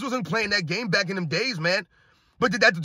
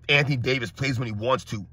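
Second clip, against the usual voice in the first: much lower mock-quote voice; mocking fan imitation; 8 semitones lower, 3 dB quieter than usual. Host parroting fans' jab at Anthony Davis, low mocking imitation. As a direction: parrot the excuse in a dull low voice. Mimics fan's comment, mocking.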